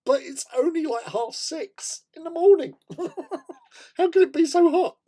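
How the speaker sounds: high, loud, laughing mock outrage at the heat; retelling her own shocked giggle; shocked laughing disbelief. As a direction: squeal it out in amused disbelief. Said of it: "And I'm going".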